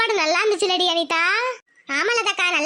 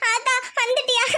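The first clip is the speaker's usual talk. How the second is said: crying; 3 semitones higher than usual. Acted. Daughter greets mother on her return, crying. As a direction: greet through broken sobs. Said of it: "எதுக்குடி அழுவுற?".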